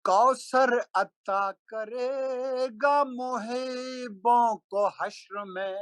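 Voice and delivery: slow melodic chanted Urdu verse; stately nazm recitation. Acted. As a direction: intone each line like a mushaira poet. Recites a manqabat at a gathering.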